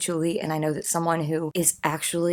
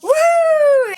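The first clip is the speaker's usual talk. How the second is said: much higher, much louder joyful woo; celebration whoop; 11 dB louder than usual. Real, not acted. Celebrating getting rid of the scale, real joyful whoop. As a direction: cheer it out like a victory.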